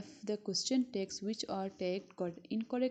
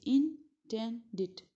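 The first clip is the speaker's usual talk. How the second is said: normal talking voice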